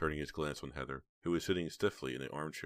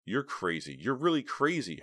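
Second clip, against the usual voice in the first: rising to high, louder disbelief; appalled disbelief; 8 semitones higher, 5 dB louder than usual. A narrator's reading. Heather reacts to the demand, appalled and incredulous. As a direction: build the incredulity on the repeat. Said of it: "shaking her head".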